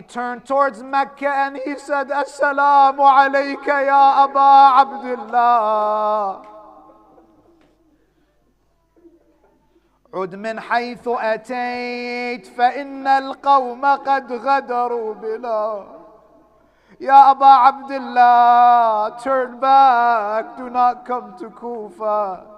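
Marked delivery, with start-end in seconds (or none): 2.1-15.9 mournful farewell. Retold or imitated. 17.0-22.5 slow, loud mournful call; loud grieving cry; lamenting cry. Acted. Preacher cries out in lament.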